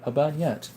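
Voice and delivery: bayonet said "bahn-yet", slightly Frenchified; French-sounding pronunciation. Retold or imitated. Streamer recalling a viewer's tease, bayonet as French-like "bahn-yet". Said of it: "you sound like a Frenchman".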